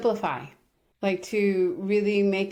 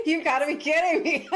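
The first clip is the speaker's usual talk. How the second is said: laughing; 9 semitones higher than usual. Retold or imitated. Retold reply, laugh heard.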